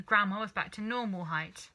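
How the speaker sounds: plain reading voice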